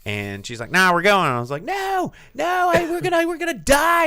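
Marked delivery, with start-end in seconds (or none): 0.7-1.3 loud, higher-pitched retort; firm overruling. Retold or imitated. Grandmother overruling a scared child, loud and insistent. 1.6-4.1 very high, loud mock shriek; panicked protest. Child begging grandmother not to ride, hammed-up panic.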